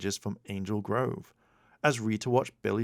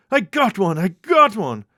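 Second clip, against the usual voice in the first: higher, much louder triumphant yell; excited shout; 10 semitones higher, 11 dB louder than usual. A narrator's reading. Bulk yelling about a fish on his line. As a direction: yell it twice, thrilled. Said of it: "Bulk shouted".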